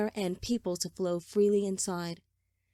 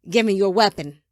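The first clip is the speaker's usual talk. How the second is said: loud, demanding order; forceful command; 11 dB louder than usual. A narrator's reading. Father demanding his son's weapon, loud command. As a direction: order it at full voice.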